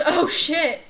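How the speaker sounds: loud, laughing exclamation; laughing mock alarm. Student recalling an intimidating teacher, laughing mock alarm. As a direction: blurt it loudly through laughter. Retells terror while laughing.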